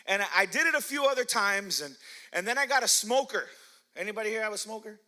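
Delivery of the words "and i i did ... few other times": ordinary talk, nothing marked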